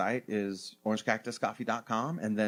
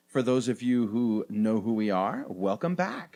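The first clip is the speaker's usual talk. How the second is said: usual speaking voice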